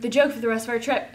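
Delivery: ordinary talk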